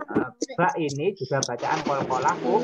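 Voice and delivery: ordinary talk, nothing marked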